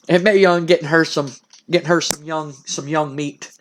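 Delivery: normal talking voice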